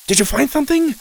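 very high-pitched eager question; excited. A narrator's reading. Friend scrambling over to the digger, excited. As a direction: ask eagerly, voice way up. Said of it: "scrambled over excitedly".

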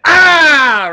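very high, loud startling scream; sudden loud yell. Retold or imitated. Passenger yells to get somebody's attention, startlingly loud. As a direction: blast out a sudden "Ah!". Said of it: "really loud".

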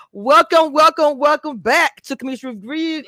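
loud, high welcome to viewers; hyped show intro. Real, not acted. Host restarts her show intro.

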